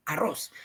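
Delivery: "arroz" said softly with the weight on "rroz"; final-syllable stress. Shown as a demonstration. Teacher repeats it for the class: "arroz" stressed on "rroz". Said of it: "Ma-, mayor punto".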